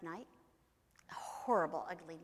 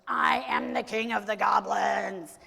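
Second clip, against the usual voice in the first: enraged goblin king; 2 semitones higher, 14 dB louder than usual. Acted. Goblin king demands respect from Herschel, loud commanding shout. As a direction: proclaim it with full force.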